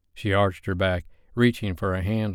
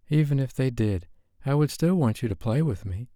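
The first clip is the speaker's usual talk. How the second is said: higher woman's voice, sincere; wife pleading sincerely; wife, earnest; 5 semitones higher than usual. A narrator's reading. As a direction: reassure him gently. Wife voice held.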